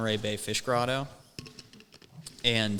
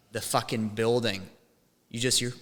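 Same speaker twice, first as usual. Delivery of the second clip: ordinary delivery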